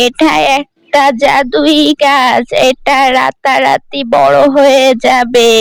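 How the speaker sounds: put-on slow, mysterious old woman's voice. Old woman telling Priya the tree is magic, slow and mysterious. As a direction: hand over the secret slowly.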